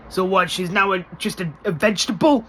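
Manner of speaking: high, loud disbelieving question; stunned Oz, a touch of horror; shocked question. Retold or imitated. Oz quoted, shocked.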